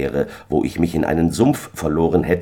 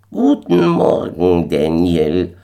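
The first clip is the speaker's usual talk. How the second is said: malicious moon man calling; 4 dB louder, slower than usual. A narrator's reading. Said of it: "rief der kleine boshafte Racker".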